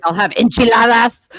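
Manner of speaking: hammed-up American accent on the Spanish words.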